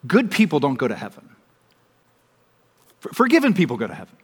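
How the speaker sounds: Good stressed, then forgiven punched. Shown as a demonstration. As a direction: contrast good people with forgiven people. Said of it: "Let me put it to you like this".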